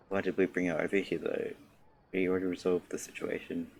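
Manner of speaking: ordinary talk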